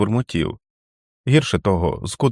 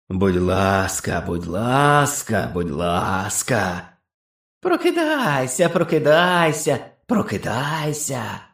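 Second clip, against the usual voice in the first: inhuman chorus mocking; 3 semitones higher, much slower than usual. Beast chorus mocking again.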